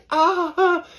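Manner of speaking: high, loud imitation of his own pained yelp; laughing replay of a pained yelp; mock scream. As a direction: relive the scream, shrill and short. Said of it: "And I'm screaming".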